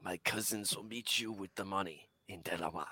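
a hint of a shady mobster; mock gangster. Pretend mob deal, softly menacing.

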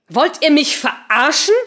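loud, high, slow indignant question. A narrator's reading. Patricia to the silent room, loud disbelieving question. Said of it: "fragte Patricia laut".

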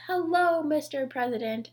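mock chorus shout, pitched high and falling; children shouting in chorus. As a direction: imitate kids calling out together. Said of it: "getting them to shout in a chorus".

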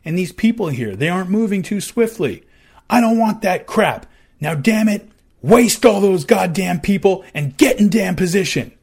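loud, high barked orders; furious commander shouting orders; angry officer yelling. Retold or imitated. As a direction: yell at the troops to move. Officer barking orders at troops.

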